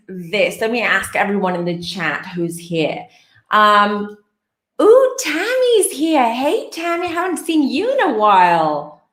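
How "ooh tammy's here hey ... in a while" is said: excited greeting. Real, not acted. Greeting returning viewer happily.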